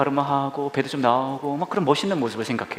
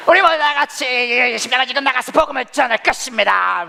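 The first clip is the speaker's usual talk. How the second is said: higher, louder proclaiming; charismatic preacher; 9 semitones higher, 4 dB louder than usual. Retold or imitated. Preacher mimicking a rally preacher sending out believers, loud. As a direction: send them out with fire.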